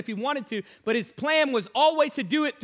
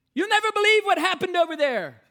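excited; 8 semitones higher, 6 dB louder than usual. Retold or imitated. Tom eagerly telling his testimony.

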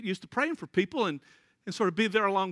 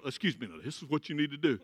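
lower, softer know-it-all adult; mock fussy adult; 7 semitones lower, 5 dB quieter, faster than usual. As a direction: talk down to the boy, hushed. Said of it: "come along and go".

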